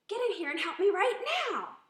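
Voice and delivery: loud, high, demanding shout; disrespectful yell. Shown as a demonstration. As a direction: bark an order at the husband. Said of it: "the disrespectful way".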